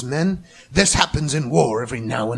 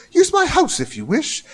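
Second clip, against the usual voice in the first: higher-pitched character voice, a mild mock yell; yelling chap; 11 semitones higher than usual. A narrator's reading. Chap in a slicker warns the narrator to flee, higher mock yell. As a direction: voice the soaked stranger calling a warning over the storm. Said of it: "he yelled".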